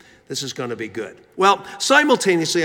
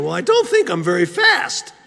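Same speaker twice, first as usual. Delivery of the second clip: put-on squeaky little boy, pitched up; squeaky pre-adolescent voice; 3 semitones higher than usual. Respectful student answering his teacher after class, in a squeaky boy's voice. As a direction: imitate a timid squeaky kid voice. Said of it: "in my little squeaky post-adolescent".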